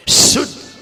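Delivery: शुद्ध with a strong hissed "sh"; whistling sibilant. Shown as a demonstration. Teacher retells showing a class, whistling शुद्ध. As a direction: whistle hard into शुद्ध, end short. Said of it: "ऐसे पढ़िए".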